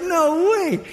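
high, incredulous exclamation; mock disbelief. Preacher reacting to Jesus telling Peter to come, mock disbelief. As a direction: push it up high in hammed-up disbelief.

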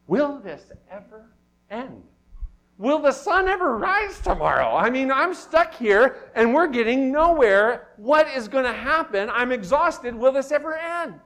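exhausted late-night lament, pitched high; exasperated despair. Acted. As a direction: plead as if the night will never end. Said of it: "I feel that way sometimes".

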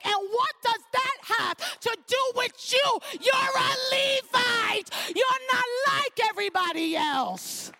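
mock stern parent shouting; stern mother. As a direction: lay down the law to a child. Mother scolding a whining child.

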